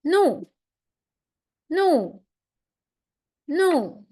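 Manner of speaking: "nu" falling sharply from high, stressed; fourth tone nu. Shown as a demonstration. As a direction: drop "nu" hard from the top, fourth tone. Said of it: "and the last tone".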